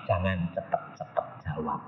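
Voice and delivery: very low, very soft warning; hushed inner voice. Retold or imitated. Holy Spirit warns the preacher not to answer, hushed. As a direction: barely voice it, like a private prompt. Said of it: "Roh Kudus ngomong".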